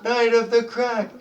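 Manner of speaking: high, loud, slow cheer; goblins cheering. Acted. Goblins cheer the heroes, chanting loudly and high. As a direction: chant it like a victorious crowd. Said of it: "And they're yelling".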